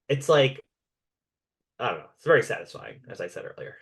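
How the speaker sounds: normal talking voice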